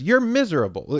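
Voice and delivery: lightly mock the rude lady's insistence; a hint of a nasty stranger. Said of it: "And she goes".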